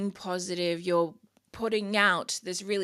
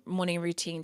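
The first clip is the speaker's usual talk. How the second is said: ordinary talk, nothing marked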